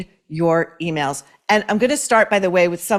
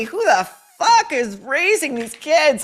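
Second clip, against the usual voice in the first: pitched way up and slowed, in disbelief; exasperated outburst; 7 semitones higher than usual. Real, not acted. Host reacting to a listener's email, exasperated disbelief. Outraged at a parenting lesson.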